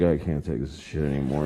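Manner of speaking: low, drained voice of a worn-out parent; exhausted parent. Retold or imitated. Worn-out mother at McDonald's, low and defeated. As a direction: sag into defeat. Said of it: "the parent's like".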